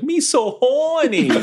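high, slow hammed-up soundbite; soundbite impression. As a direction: drag it out like a cheesy sample. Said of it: "that woman being like".

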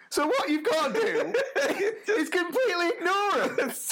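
high, teary laughing voice; laughing near tears. Real, not acted. Teacher retelling his advice, laughing near tears. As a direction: try to talk while laughter wells up. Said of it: "I can feel my eyes starting to fill".

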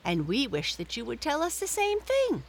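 high, eager breeze voice; eager cry. Acted. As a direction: cry out together. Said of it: "cried one of the Merry Little Breezes".

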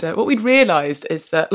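ordinary talk

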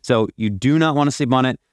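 ordinary talk, nothing marked

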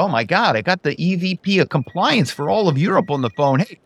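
imitating a thrilled, fawning vice president, pitched well up; starstruck eager VP. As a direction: gush like you just got the big boss on the line. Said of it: "the vice president would go".

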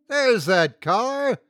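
high, drawn-out gleeful exclamation; exclaiming. A narrator's reading. Hank exclaims at the bloody mess, high and excited. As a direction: exclaim it high, stretching the words. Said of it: "Hank exclaimed".

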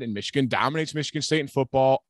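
usual speaking voice

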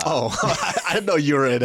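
amused mock apology, laughing; teasing laughter. Real, not acted. Teasing co-host over nickname, laughing.

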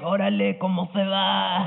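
French attempted in a Mexican accent. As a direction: put on a comic Mexican accent. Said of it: "speak French with a Mexican accent".

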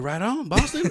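high-pitched mock driver cheer; excited driver. Driver hearing they're from Boston, high excited impression.

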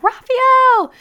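piercing high yell of a name; screaming a name. Retold or imitated. Calling her husband for help, in a piercing high scream. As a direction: scream the name across the house. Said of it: "screaming bloody murder".

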